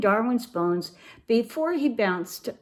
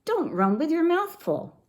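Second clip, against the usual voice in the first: mild holler, pitched up high; 9 semitones higher than usual. A narrator's reading. Mama to her kitty running by, mild high-pitched holler. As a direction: call it out high, without much volume. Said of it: "hollered Mama".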